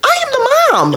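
high, emphatic mock outrage; acted-out exasperated declaration, pitched high; indignant mom. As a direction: assert your authority over a cheeky kid. Mom asserting herself to her son.

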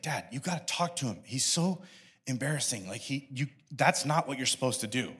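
low, soft plea to dad; hushed, halting disapproval from a son; subdued complaint. Retold or imitated. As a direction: imitate an embarrassed kid quietly complaining. Son's later complaint to dad.

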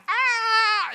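high mock toddler wail; toddler scream. Dad imitates daughter's scared scream. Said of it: "she goes from, like".

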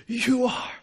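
strained, high push of words; acted dying exertion; pained effort. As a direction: heave the words out through pain. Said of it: "imagine the effort and the pain".

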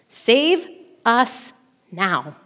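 very slow, word by word; desperate plea. Retold or imitated. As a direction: plead with each word standing alone. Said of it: "You can hear their desperation".

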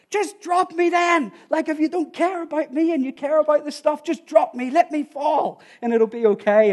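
high-pitched, hammed-up outburst; retold exasperated yell. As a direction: act out fed-up yelling in a quarrel. Exasperated husband yelling in retold quarrel.

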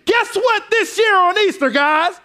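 high, shouted reveal, slowing on the end; playful preacher proclaiming the empty tomb; mock big Easter announcement. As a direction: ham up the pastor's Easter punchline. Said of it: "Pastor gotta get up here and say".

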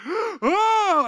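sudden high yelp of fright; startled high shriek; high scream. Retold or imitated. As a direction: shriek it out in shock. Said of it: "so I screamed".

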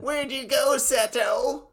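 put-on high boyish anime kid voice; calling out for his brother, high; Mokuba voice. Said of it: "that's more Mokuba".

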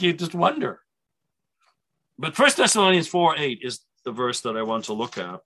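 ordinary delivery

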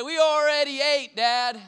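put-on high, loud child's voice; the kids yelling up to dad, high and loud; kid's voice. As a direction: voice the kids calling up.

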